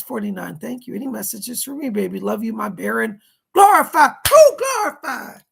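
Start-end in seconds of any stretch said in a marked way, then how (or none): 3.5-5.4 excited cry. Acted. Donor's lucky word, cried out.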